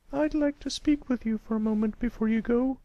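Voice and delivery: high patient teacher voice. A narrator's reading. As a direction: speak as a kindly schoolteacher to a pupil. Miss Shirley voiced.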